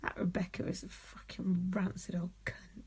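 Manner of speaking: hushed, whispered dig; whispered insult. Real, not acted. Co-host slagging off Rebecca under the breath, whispered insult. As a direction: whisper it as a catty aside.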